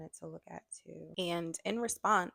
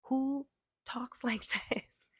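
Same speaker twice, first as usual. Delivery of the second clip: high breathy laugh through the words, slow; can't believe anyone writes like this; incredulous laughing whisper; 9 semitones higher, slower than usual. Real, not acted. Vlogger laughing at the lawyer's letter, whispered and incredulous.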